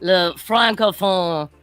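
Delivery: slow, hammed-up French accent; English in a stagey, drawn-out French accent. Shown as a demonstration. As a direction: put on a fake French accent, drawing words out. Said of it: "English can you do in a French accent".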